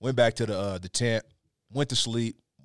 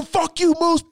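much higher, louder retold yell; yelling at moose; 5 dB louder, slower than usual. Hunter yelling the moose away from his tent, high loud yell. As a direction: scream it at the animal to scare it off.

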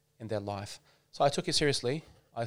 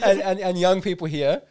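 laughs it off, high and loud; laughing through the words; 10 semitones higher, 16 dB louder than usual. Real, not acted. Sheikh laughing about his accent with hosts, laughing. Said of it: "I love that".

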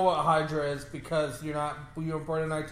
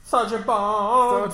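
dramatic name call; 2 semitones higher, 6 dB louder, much slower than usual. Pretend.

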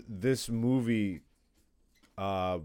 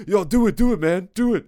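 pleading friends; 5 semitones higher, 5 dB louder than usual. Retold or imitated. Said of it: "Everybody used to make me fucking be like".

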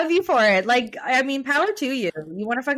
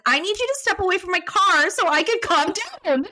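high-pitched mock crying plea; retold tearful demand; 9 semitones higher than usual. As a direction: wail it at the cop, hammed-up. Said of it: "I couldn't stop crying".

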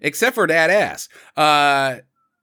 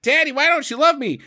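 high-pitched imitation of a child; pleading kid; 9 semitones higher than usual. Kid begging his father for love, mock pleading. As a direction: plead with daddy in a put-on little voice. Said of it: "his kid's like".

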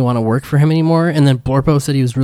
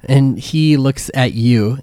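ordinary talk

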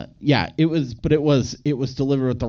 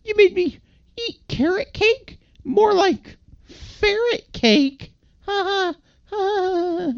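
very high falsetto, dragging the punchline; silly high-pitched creature impression; mocking character voice; slower than usual. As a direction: squeal a bad pun, then a slow fake laugh. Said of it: "he talks like this the whole time".

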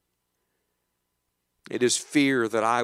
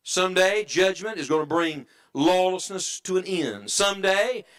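ordinary talk

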